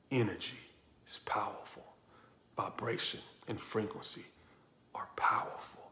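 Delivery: soft whispered emphasis; hushed whisper. Acted. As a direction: drop to a whisper for effect.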